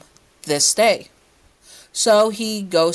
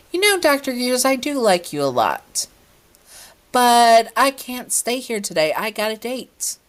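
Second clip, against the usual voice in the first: playful character voice; 7 semitones higher than usual. A narrator's reading.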